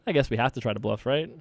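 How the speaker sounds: usual speaking voice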